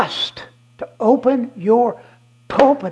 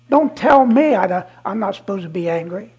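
usual speaking voice

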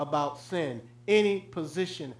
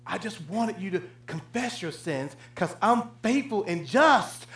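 usual speaking voice

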